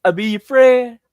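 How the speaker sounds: Kermit-like attempt at a Southern man; Southern accent. Quoting Joker with gun to his head, mock Southern. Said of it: "He sounded so Southern".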